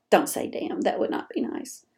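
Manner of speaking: hushed playful whisper; whispered aside. Real, not acted. Host whispering to listeners after swearing, hushed. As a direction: whisper it like a confession.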